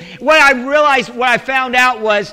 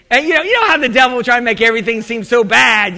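normal talking voice

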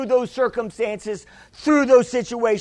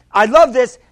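ordinary delivery

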